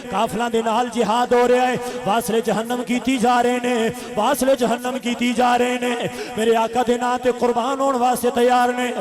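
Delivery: loud, ringing sermon chant; full-force preaching. A narrator's reading. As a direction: preach the battle story at full volume. Preaches the battle story.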